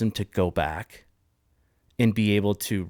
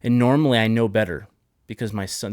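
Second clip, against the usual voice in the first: ordinary talk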